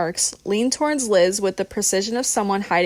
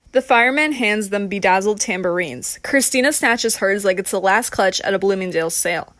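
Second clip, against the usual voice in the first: plain reading voice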